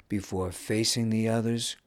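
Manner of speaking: ordinary delivery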